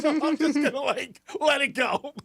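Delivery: slowed, laughing impression of a resigned manager; mimicking manager. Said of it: "He goes".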